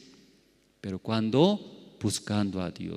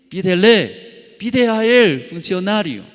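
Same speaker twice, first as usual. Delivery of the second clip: higher and louder, pressing command; loud insistent voice; 8 semitones higher, 9 dB louder than usual. Retold or imitated. God's answer, retold.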